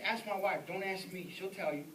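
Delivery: ordinary talk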